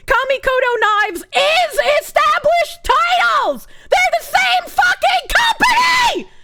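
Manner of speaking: slow, high, screaming rage; furious shout. Real, not acted. Streamer yelling about the scam company, furious scream. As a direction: scream the accusation with everything. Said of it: "I'm so fucking angry".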